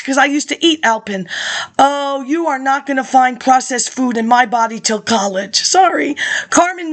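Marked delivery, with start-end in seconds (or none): none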